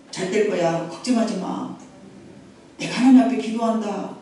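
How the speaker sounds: low, soft, comforting parent; tender reassurance. Acted. Voicing encouraging parent to child.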